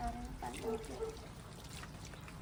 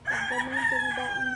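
excited; 6 dB louder than usual. Real, not acted. High excited explaining.

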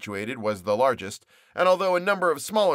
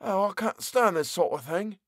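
higher, exasperated exclaim; 7 semitones higher than usual. Acted. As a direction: burst out in frustration. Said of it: "he exclaimed".

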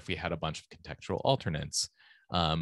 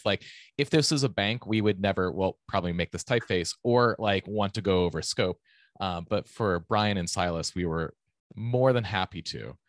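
ordinary talk, nothing marked

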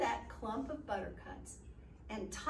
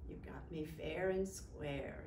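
leprechaun; 8 semitones lower, 7 dB quieter, slower than usual. Acted. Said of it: "said the leprechaun".

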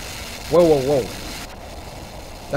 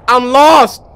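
loud, higher-pitched cry; distressed yell; 4 semitones higher, 8 dB louder than usual. Real, not acted. Player lost in the woods, distressed yell.

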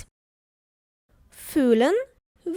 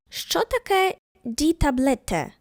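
usual speaking voice

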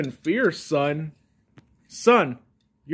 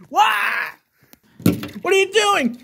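shocked shriek; 13 semitones higher than usual. Acted. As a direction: burst out in alarm at what you see. Sudden shocked outburst.

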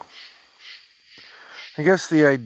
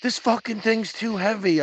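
higher-pitched mock yell, little added volume; imitated yell; 9 semitones higher than usual. Garbage man complaining to resident about heavy load, imitated yell. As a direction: call it out like an annoyed garbage man. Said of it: "he yelled over at me".

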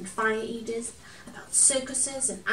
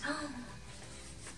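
gasp; 5 semitones higher, 11 dB quieter than usual. Acted. Wonder at the story.

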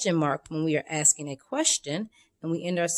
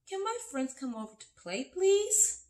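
higher, begging lift on please; hopeful request, climbing to a high "please"; pleading question; 11 semitones higher than usual. Shown as a demonstration. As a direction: ask like a child begging permission. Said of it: "Your question could be".

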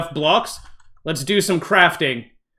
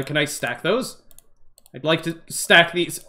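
normal talking voice

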